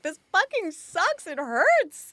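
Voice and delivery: high, loud, slow whiny complaint; exaggerated pained complaint. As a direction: wail it like it's unbearable. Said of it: "And I'm like".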